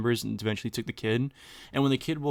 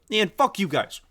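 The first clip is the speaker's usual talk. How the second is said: higher, louder mock outburst; imitated fed-up kid; 9 semitones higher, 5 dB louder than usual. Kid whose family pissed him off, mock angry outburst. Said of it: "the kid's like".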